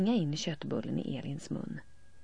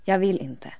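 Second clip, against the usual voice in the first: loud refusal; 3 semitones higher, 9 dB louder than usual. A narrator's reading. Elin refusing.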